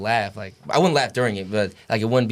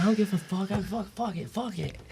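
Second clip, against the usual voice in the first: sulky defiance; 9 semitones higher, 7 dB quieter than usual. Retold or imitated. Freshman sulking back at coach.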